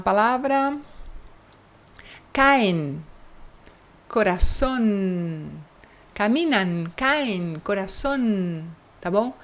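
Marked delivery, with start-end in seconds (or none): 4.1-5.5 "Corazón" very slow with a clear tongue-tip N; teacher overdoing the final N; exaggerated final N. Shown as a demonstration. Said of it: "É assim exagerado mesmo". 7.6-8.6 "corazón" slow with a clear final N; teacher modelling Spanish N; exaggerated final N. Shown as a demonstration. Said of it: "É assim exagerado mesmo".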